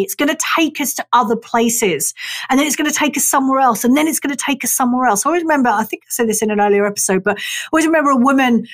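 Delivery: normal talking voice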